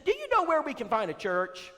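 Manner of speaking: high falsetto woman; Brenda's voice. Retold or imitated. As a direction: address him in her voice. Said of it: "she says".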